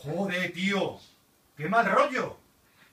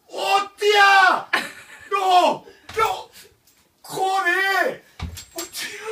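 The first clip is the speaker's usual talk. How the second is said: high, loud terrified screams; screaming while falling; 12 semitones higher, 9 dB louder, much slower than usual. Real, not acted. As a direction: scream as if dropping. Virtual fall reaction.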